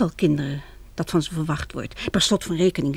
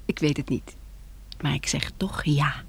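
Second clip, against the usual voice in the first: tender whisper; 4 semitones lower, 6 dB quieter than usual. Acted. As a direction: let the yes slip out quietly. Accepting marriage proposal.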